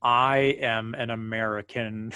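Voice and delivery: slow, stiff put-on American accent, imitating Cumberbatch; lightly mock a Brit forcing an American accent. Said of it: "when actors have to do the American accent".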